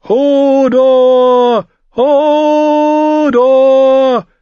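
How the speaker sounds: long, high, loud call. Acted. Bran calls Hodor from afar, long, high and loud. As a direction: call across the woods with hands cupped. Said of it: "rief er".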